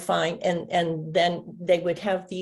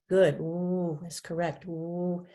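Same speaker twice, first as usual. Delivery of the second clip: ordinary talk, nothing marked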